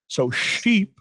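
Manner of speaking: "sheep" with the sh and long ee drawn out; stretched sheep. Shown as a demonstration. Teacher contrasting sheep with cheap, stretched. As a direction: draw out "sheep" slowly. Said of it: "sound is easy to extend".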